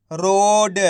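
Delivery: रोड with a long held "o" and light final ड; half final letter. Shown as a demonstration. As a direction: draw out रोड for learners, halving the ड. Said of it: "एक एक अक्षर का उच्चारण करके". (रोड को खींचकर, आखिरी ड आधा)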